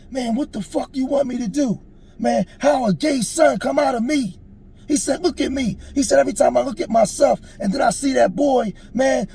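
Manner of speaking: acting out an upset father's outburst; heated, anguished outburst. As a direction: throw it in his face, distraught. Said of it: "as it's getting heated, he looked me right in my face".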